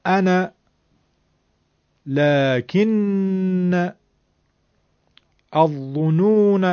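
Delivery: انا لکن الظنون each said slowly with a short final fatha; fatha pronunciation. Shown as a demonstration. The teacher pronounces the seven words for students. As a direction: isolate each word, ending on a short a.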